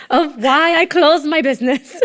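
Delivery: real laugh breaking through the last words. Real, not acted. Guest finishes her hard story with a relieved laugh.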